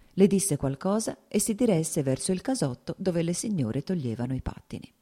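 usual speaking voice

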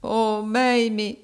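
acted tearful lament. A narrator's reading. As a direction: draw the name out as a weeping boy would. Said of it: "egli rispose piangendo".

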